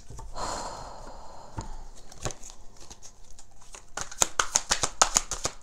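a long, breathy, unvoiced exhale; long deep sigh. Real, not acted. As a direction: let out a long emotional breath. Long pause after emotional reading.

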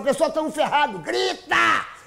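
hammed-up call to panic; comic cry of alarm; mock panicked shout. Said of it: "E quem fala".

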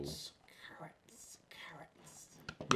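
soft whispered chant; whispering. Real, not acted. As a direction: whisper the name three times. Chanting channel name under breath.

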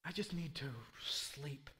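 low, hushed, drained mutter; worn out, sinking to a near whisper; weary frustration. Acted. Worn-out frog victim giving up for bed, hushed frustration. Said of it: "so frustrated, you're just like".

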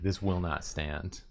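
mock solemn. Mock-solemn declaration of outrage.

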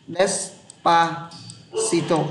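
syllable by syllable. Shown as a demonstration. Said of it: "phát âm của nó y hệt như mặt chữ". (đọc Despacito thật chậm, tách từng âm tiết)

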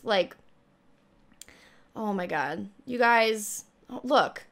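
usual speaking voice

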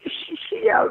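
real tearful stammer, pitched high; high, halting voice through tears; crying. Real, not acted. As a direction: break down and stumble over the words. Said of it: "I'm crying".

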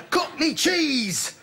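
high, drawn-out pitchman's cry; over-exclaimed slogan. Acted. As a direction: sell it with far too many exclamation marks. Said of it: "way too many exclamation marks after it".

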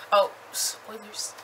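ordinary talk

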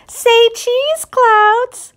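high, sing-song photographer's call; playful girl voice. Acted. Ruthie playfully posing the clouds for a photo. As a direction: coax the clouds to smile.

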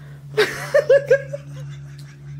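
high shrieking laugh. Real, not acted. Girlfriend laughs at the shorts.

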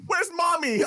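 high-pitched child imitation; dismissive little girl. Daughter ignores visitor asking for mother, high child voice. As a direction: mimic a small kid changing the subject.

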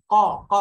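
"ক" shot out hard and very loud; hard qaf. Shown as a demonstration. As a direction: hit "ক" hard from the back of the throat. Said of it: "একটু শক্ত উচ্চারণ". ("ক" শক্ত করে জোরে)

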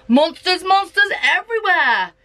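high, rising alarm at the monsters; dramatic exclamation. A narrator's reading. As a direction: read it like a thrilling reveal. Spooky scene read with drama.

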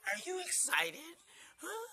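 high, loud, eager question; excited puppy talk. Real, not acted. Owner asking puppy if he is excited, excited puppy talk. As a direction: rile up the puppy.

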